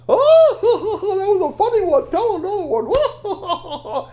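high, loud laughing through the words; hammed-up guffawing. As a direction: roar with mock laughter at a joke. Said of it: "Strongbones was still telling jokes".

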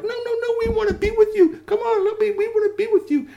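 very high, loud squeaky pleading; tiny toy voices. Acted. Little woodsies begging to join, very high squeak.

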